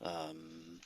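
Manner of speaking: halting, polite foreign worker asking the way; Russian accent. Acted. Miner NPC continues.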